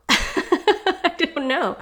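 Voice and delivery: slow, high laughing repeat. Real, not acted. Host laughing after reading the passage, bemused.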